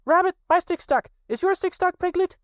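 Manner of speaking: put-on very high, eager child voice; little Roo chattering. A narrator's reading. Little Roo pesters his friends about their sticks, high and excited. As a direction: tumble the questions out like an impatient little one.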